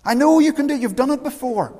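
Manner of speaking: high, loud urgent plea; a preacher voicing David's loud, high plea; pleading cry to God. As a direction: beg God to act again, voice up high and loud.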